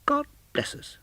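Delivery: put-on very high, gentle child's blessing; small boy's sweet blessing; Tiny Tim. Tiny Tim blessing everyone at the end, childlike. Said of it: "as Tiny Tim observed".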